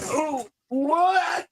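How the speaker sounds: groggy surprise. Retold or imitated. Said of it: "And I was like".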